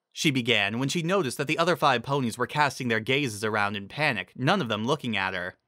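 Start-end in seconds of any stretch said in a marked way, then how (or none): none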